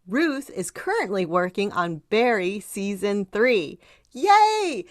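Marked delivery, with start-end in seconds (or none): 4.1-4.8 excited cheer. Real, not acted. Host cheers guest's credits.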